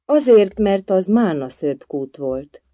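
loud high first word, then slowing; laboured, belated answer; groaned out. A narrator's reading. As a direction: force the answer out, then drag it. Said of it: "majd kinyögte".

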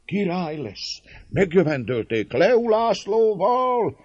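high, slow cheering cry; excited cheers. A narrator's reading. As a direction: cheer him as king. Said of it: "E kiáltások".

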